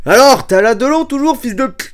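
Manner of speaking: loud, high shouted taunt; mock furious cop. Policeman back at Alain Delon's cell, mock furious shout. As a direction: yell it like a policeman out of patience. Acted policeman losing patience.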